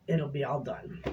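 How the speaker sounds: ordinary delivery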